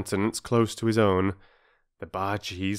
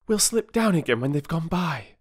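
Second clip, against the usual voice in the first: a hushed, hopeful schoolgirl voice; lightly voiced girl, plotting; young girl; 9 semitones higher than usual. A narrator's reading. Bobby whispering a plan to her siblings, lightly girlish. Girl's voice.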